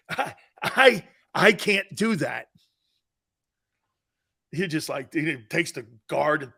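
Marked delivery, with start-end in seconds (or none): 0.1-2.5 mock disbelief. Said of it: "you shake your head and go".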